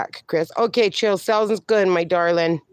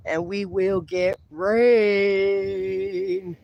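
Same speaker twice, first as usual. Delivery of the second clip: drawn-out anticipation; much slower than usual. Real, not acted. Drawing out the news of rain.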